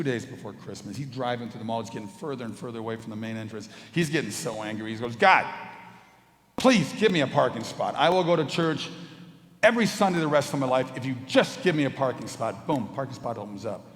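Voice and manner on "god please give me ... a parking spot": loud, high, frantic begging to God; frustrated driver yelling a prayer; desperate plea. Retold or imitated. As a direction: beg God for a parking spot at full volume. Angry man begging for parking.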